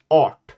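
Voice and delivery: art with Swedish open a and tapped r. Shown as a demonstration. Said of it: "In Swedish, there is also a word".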